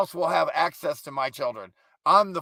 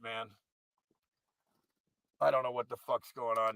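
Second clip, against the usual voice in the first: ordinary talk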